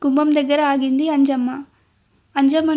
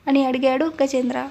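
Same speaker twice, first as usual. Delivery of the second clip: ordinary delivery, nothing marked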